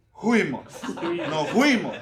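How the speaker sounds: "Fuimos" said as "Juimos"; the F of "Fuimos" swapped for a J sound; wrong F as J. Shown as a demonstration. Pastor showing the sloppy "Fuimos" with a J. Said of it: "Cambiar la F por la J".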